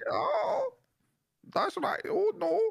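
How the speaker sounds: very high mock German accent, each bit ending on a high "Ah"; mock German accent with a squeaky high "Ah" tacked on. Shown as a demonstration. Showing friends how Germans talk, very high mock German accent. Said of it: "Just go, "Ah," at the end of it".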